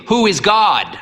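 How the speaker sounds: high, booming drill question; martial tone. A narrator's reading. Old priest quizzing the boy on the catechism, martial. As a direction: bark it like a drill sergeant.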